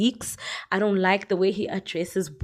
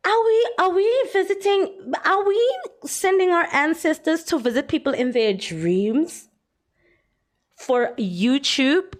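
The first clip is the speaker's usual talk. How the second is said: high, mocking disbelief; incredulous; 8 semitones higher than usual. Real, not acted. Vlogger mocking ancestors sent for YouTube. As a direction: ridicule the idea.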